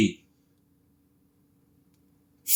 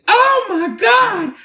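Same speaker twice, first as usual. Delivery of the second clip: long, high mock wail; imitated scream; much slower than usual. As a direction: drag out a horrified high shriek. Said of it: "he screamed, he cried".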